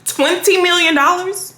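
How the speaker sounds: high, disbelieving question; incredulous. Shown as a demonstration. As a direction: throw it back as if insulted by the offer. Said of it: "Love that look I just gave".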